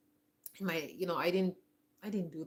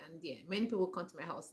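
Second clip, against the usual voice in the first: usual speaking voice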